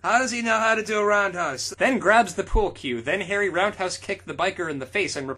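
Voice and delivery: hammed-up fanfic narration; dramatic reading. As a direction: read it like an action climax.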